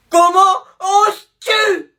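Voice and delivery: very high, slow, loud sobbing; wailing outrage. Acted. Girlfriend outraged at his love for Bulma, slow crying wail.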